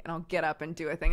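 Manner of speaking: ordinary delivery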